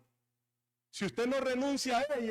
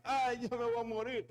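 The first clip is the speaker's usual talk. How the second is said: mock whine; 3 semitones higher than usual. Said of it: "Le pega alguna cosita, una gripita".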